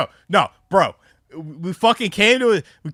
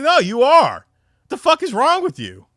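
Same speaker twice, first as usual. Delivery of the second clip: incredulous retort; 8 semitones higher, 4 dB louder than usual. Retold or imitated. Arguing back at friend.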